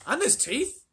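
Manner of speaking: put-on high, loud thrilled boy; boy crying out in delight; excited cry. A narrator's reading. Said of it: "cried Charlie".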